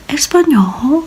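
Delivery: imitating a boy who can't believe it. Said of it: "He was, like, so surprised too".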